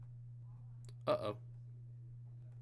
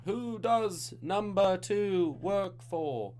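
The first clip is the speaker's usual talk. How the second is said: slow drawn-out put-on movie voice, pitched up; quoting a movie line; 8 semitones higher, much slower than usual. Host quoting a movie for bonus point, slow put-on voice. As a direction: stretch the quote out like the film villain. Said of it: "What did I just quote?".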